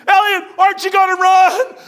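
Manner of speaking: high frantic yell of alarm; panicked shout. Retold or imitated. Boss panicking at the preacher to run. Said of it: "I'm looking".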